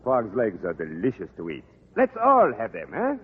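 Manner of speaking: put-on thick French accent, a charming Frenchman; French accent, courtly and flattering. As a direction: play the suave Parisian teacher, French accent.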